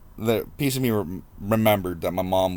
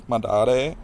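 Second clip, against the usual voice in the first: higher, louder, with a playful rising lilt; mock childish; 5 semitones higher, 5 dB louder, slower than usual. Son jokes about his real dad, mock childish. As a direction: put on a mock little-kid voice.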